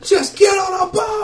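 high, drawn-out frantic shout; hysterical kid. Acted. Fleeing kid to party at the boats, hysterical. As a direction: shriek the order like a panicked youngster. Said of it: "He's hysterical".

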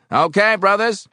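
high, loud call to the brothers; loud call to a meeting. A narrator's reading. Union man letting a visitor go, calling out loudly.

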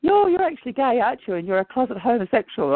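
playful high teasing, pitched well up; teasing joke. Retold or imitated. To the male voice she hears, teasing him high. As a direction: tease him, high and sing-song. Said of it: "so I actually joke with him".

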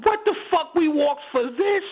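high, disbelieving mock cry; incredulous outburst. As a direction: squeal it like you can't believe the hike was for this. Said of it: "I'm sitting there going".

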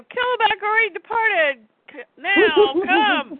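high-pitched put-on creature voice; alien minion voice. Parademons tell each other to leave now, high minion voice. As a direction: urge the troops on as a squeaky cartoon minion. Parademons answer one another while fleeing.

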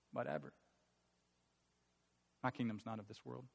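low, soft, unbothered; dismissive reply. Acted. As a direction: shrug it off quietly in a dropped voice. Voices Jesus's reply, as dismissive.

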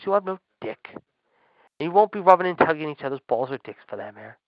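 ordinary talk, nothing marked

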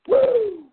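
high wrestling-promo woo, yelped out; Ric Flair woo. Retold or imitated. Host imitating Ric Flair in a wrestling promo, piercing high woo.

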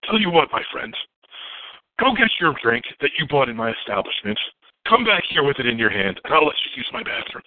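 lightly voiced mobster owner; smirking wise guy. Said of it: "And he goes".

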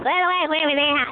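imitating a high squeaky voice. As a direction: pitch up into a thin squeak. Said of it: "that squeaky voice".

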